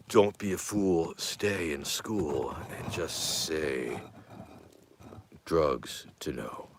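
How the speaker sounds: slow, drawn-out slogan; mock PSA. Streamer gives a young viewer a caveat, mock PSA. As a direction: stretch each phrase out slowly, pausing between.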